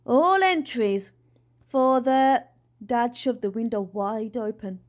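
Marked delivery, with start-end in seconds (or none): 0.1-2.5 loud, slow, drawn-out call; megaphone announcement. A narrator's reading. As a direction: boom it out as if through a megaphone. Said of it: "He held a megaphone to his mouth".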